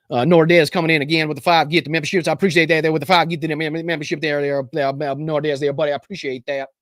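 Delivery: put-on Texan auctioneer patter; Texas auctioneer. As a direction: rattle it off like a cattle auctioneer. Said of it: "Texas auctioneer voice".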